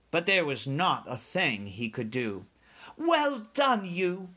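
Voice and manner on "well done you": put-on high-pitched little girl's cheer; Sophie crying out. A narrator's reading. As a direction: praise in a higher, lighter voice. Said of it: "Sophie cried".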